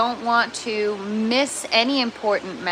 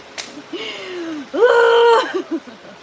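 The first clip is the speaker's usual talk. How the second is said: a high, loud laugh of disbelief; exasperated laugh; 8 semitones higher, 4 dB louder than usual. Real, not acted. Streamer laughing off spilled pepper seeds, exasperated laugh. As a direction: laugh at how bad the morning is.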